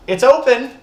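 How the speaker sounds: high, loud call down the stairs. Retold or imitated. Calling down the stairs to the stranger knocking, loud and high. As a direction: call it to someone behind the door.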